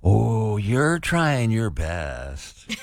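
very slow, patronizing fake sympathy; drawn-out condescending consolation. Shown as a demonstration. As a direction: swoop through a long, pitying OH. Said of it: "say it in our most condescending way".

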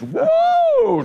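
high swooping whoa; a slow, stunned whoa up high. Real, not acted. Teller recalling the escape, a slow high whoa. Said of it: "whoa swoop".

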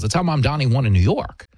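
ordinary delivery

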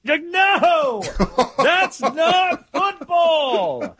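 high, very slow exasperated protest. Retold or imitated. Host rejecting the coach's motto, high and very slow. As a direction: drag it out in disbelief.

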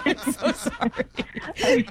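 real laughter through the words; laughing apology. Real, not acted. Prank host says sorry to the victim, laughing. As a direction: apologise while cracking up, drawn out.

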